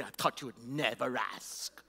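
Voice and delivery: hushed inner reply, as if to himself. Retold or imitated. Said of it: "In my mind, I would say".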